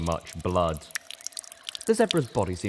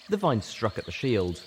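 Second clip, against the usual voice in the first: usual speaking voice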